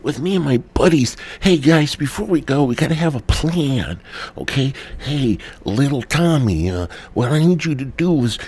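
put-on high, eager kid voice bossing his buddies; mock kid leader. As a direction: play a scheming little kid giving orders. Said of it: "the kid was like".

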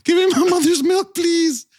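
high breathy begging kitty voice; [name] furry impression. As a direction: beg like a pop star playing a cat. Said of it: "my [name] as a furry impression".

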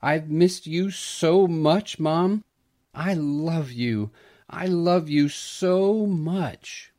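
slow, high tearful voice; tearful child hugging her mother; sobbing. A narrator's reading. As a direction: cry it out through sobs.

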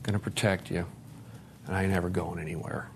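put-on soft, fading reassurance to the little kid inside; tender reassurance. Speaking softly to his inner child, tender and reassuring. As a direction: promise it gently, trailing off to near silence. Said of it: "told that little kid inside".

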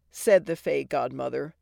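usual speaking voice

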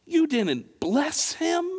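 high disbelieving question; can't believe he didn't bless him; incredulous. Pretend.

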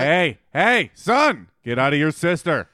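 rising calls, like a parent interrupting; mock strict scolding. Parent catches son with sister, mock stern scolding. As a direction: play at telling off a son. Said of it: "get a strict finger wag".

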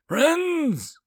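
very high, loud, incredulous cry; lashing out. A narrator's reading. Alien counselor scorning the word friends, lashing out. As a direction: spit the word back in outrage. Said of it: "Lazi lashed out".

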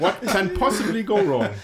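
loud, high sarcastic English quip. Real, not acted. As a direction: toss it out with heavy irony. Ironic English quip about danger.

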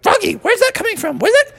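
high-pitched cartoon mom; bewildered mother. Mother hears her child but can't see, high bewildered voice. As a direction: pitch it up, searching for the voice. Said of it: "And she was like".

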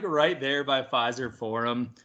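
ordinary delivery

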